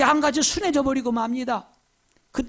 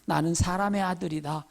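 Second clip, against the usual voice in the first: lower, softer and humble; a gently voiced humble claim; 5 semitones lower, 4 dB quieter than usual. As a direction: speak humbly, no boasting. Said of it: "겸손하게 이렇게 말하거든요".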